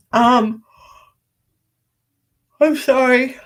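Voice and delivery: yawning through the words. Real, not acted. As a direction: speak mid-yawn. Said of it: "Sorry, I'm yawning so much".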